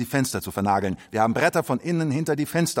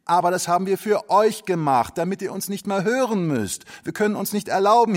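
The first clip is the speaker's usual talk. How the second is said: earnest explanation; 6 semitones higher than usual. Acted. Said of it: "haben wir gesagt".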